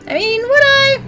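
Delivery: squeaky high doubting aside; high squeak. Acted. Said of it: "doubtful aside, high voice".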